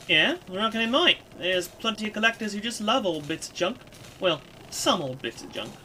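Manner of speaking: brash character voice, punching key words; argumentative Kid. Acted. Kid defending an old sword, cheekily arguing. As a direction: argue back like a cheeky youngster. Kid argues back in story.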